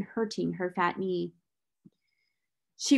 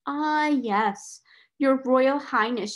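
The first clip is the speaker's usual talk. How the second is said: raised, drawn-out mock deference; 4 semitones higher, 3 dB louder, much slower than usual. A narrator's reading. Schoolgirl seizes her chance to taunt rival, mocking. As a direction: address a rival as fake royalty, slowly. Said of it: "Here was Lavinia's opportunity".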